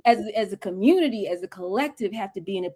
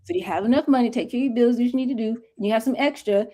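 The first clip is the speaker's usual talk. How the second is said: usual speaking voice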